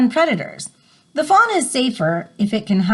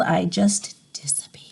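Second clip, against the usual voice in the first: soft hushed whisper; 5 semitones lower, 6 dB quieter than usual. Acted. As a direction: breathe it out as if vanishing. Poem line fading to nothing.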